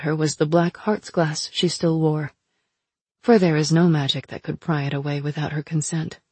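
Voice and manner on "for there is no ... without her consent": plain reading voice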